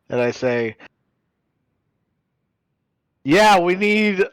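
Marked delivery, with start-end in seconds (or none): 3.2-4.2 slow, raised, drawn-out request; calling to front desk. Acted. Placing an order with the concierge desk, calling out slowly. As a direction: call it down a speaking tube, slowly.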